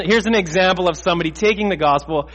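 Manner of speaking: normal talking voice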